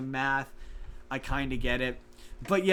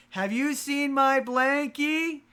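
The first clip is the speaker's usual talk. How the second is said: put-on much higher and very slow, drawn-out little-kid voice; baby dinosaur voice; 11 semitones higher, 4 dB louder, much slower than usual. Baby dinosaur grabbing the mayor's mic at the parade, high and slow. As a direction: stretch it out like a lost toddler. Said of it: "takes the microphone out of his hand and is like".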